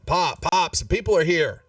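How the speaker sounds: a hint of an excited child calling Dad; boy calling out.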